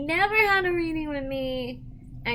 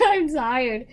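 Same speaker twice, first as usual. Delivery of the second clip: normal talking voice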